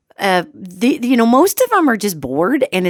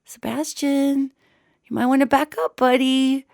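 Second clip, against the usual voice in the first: gentle warning; 5 semitones higher than usual. Retold or imitated. Owner cautioning the sniffing dog, gently wary. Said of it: "and I even say".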